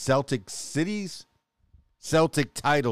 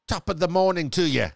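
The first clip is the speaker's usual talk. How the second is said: mock Irish accent; 6 semitones higher than usual. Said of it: "Now I'm starting to sound like a pirate".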